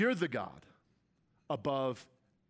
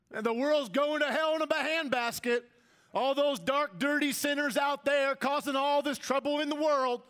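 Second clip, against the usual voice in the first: high-pitched mock Southern voice; Southern accent; 12 semitones higher, 5 dB louder than usual. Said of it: "talking a southern voice when I do that".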